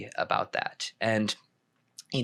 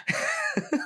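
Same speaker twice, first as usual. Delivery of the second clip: high-pitched burst of laughter. Real, not acted. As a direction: laugh at your own joke. Laughing after his accent bit.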